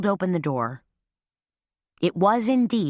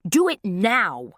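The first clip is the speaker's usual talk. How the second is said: a loud, slow, higher command, each word hammered; yelled command; 5 semitones higher, 3 dB louder, slower than usual. A narrator's reading. Carmela ordering her hesitating dogs to sit, yelling slowly. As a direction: yell it so the dogs obey. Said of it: "she yelled".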